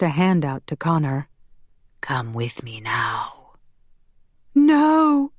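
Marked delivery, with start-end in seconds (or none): 2.0-3.5 low, slow, whispery demon summons; sinister demon. Acted. Demon beckons Connor. 4.5-5.3 high, loud scream of protest. A narrator's reading. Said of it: "Marielle screamed".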